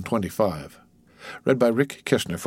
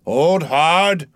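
raised voice, stretched on both words; warning shout; 6 semitones higher, 6 dB louder than usual. A narrator's reading. Old reelman warns as the line strains, loud shout.

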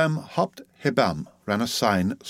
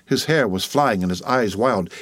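usual speaking voice

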